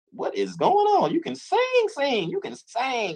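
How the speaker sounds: very high, slow retold astonishment; imitating himself floored by her voice; amazed praise.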